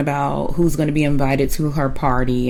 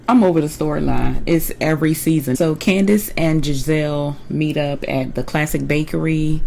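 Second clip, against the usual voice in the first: usual speaking voice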